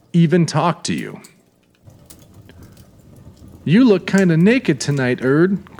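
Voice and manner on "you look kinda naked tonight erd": lightly voiced waitress, teasing and raised; playful high jab at the customer; kidding. A narrator's reading. Waitress teasing Erd about his missing gun, playful. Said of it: "Gloria kidded".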